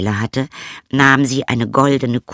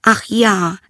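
higher, loud eager "ach ja"; eager princess; 12 semitones higher, 5 dB louder than usual. Acted. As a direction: jump at the offer like a spoiled princess. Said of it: "sagte sie".

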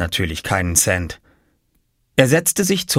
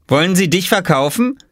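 put-on higher boy's voice teasing; mocking older brother; 9 semitones higher than usual. A narrator's reading. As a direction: tease your little brother.

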